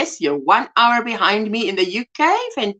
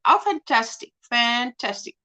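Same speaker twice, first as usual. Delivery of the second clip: ordinary delivery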